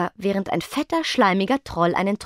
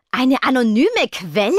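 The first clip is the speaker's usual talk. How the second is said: higher, startled echoed question; shocked; 4 semitones higher, 4 dB louder than usual. A narrator's reading. Venus hears of an anonymous source, shocked. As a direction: repeat it in disbelief, pitch raised. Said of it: "wiederholte Venus geschockt".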